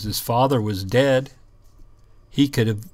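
ordinary delivery

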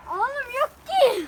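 loud, high frustrated complaint; fed up and shouting; exasperated. Real, not acted. Frustrated, can't find it.

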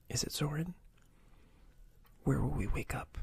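ordinary delivery

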